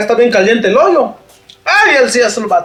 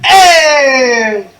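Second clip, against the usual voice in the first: cry of dismay; 4 semitones higher, 4 dB louder than usual. Acted. Dismay at missing item.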